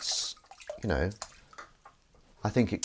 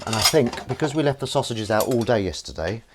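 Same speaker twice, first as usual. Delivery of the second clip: ordinary talk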